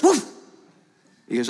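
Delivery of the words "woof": short imitated woof; one sharp mock woof; dog's bark. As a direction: bark like the dog in a joke. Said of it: "And the dog goes".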